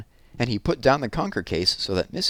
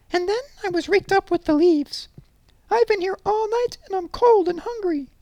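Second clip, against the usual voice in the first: tiny squeaky teddy voice; high and small like a toy bear; little bear voice; 4 dB louder than usual. Acted. Toby Small's voice.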